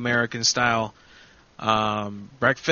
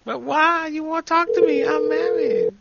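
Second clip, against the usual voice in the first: much higher, hammed-up woman's voice; high mock female voice; 14 semitones higher than usual. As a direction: squeak it out like a coy married woman. Mocking Brenda's brush-off.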